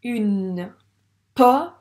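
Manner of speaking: "Une pomme" loud, in separated drawn-out pieces; sounding out slowly. Shown as a demonstration. Teacher modelling reading, "Une pomme" stretched and separated. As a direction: decode "Une pomme" piece by piece, pausing after "Une". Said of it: "À toi de lire ce mot".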